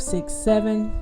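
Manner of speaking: usual speaking voice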